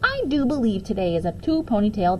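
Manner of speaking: put-on a girl speaks to herself in the mirror; girl addressing herself. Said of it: "I said to myself".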